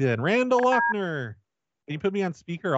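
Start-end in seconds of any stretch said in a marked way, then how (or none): 0.2-1.4 high, drawn-out holler of a name; demonstrated yell. Shown as a demonstration. Caller offers to yell it for the worker, demonstrated yell. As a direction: call it out long across the tables. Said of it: "I'll yell it for you".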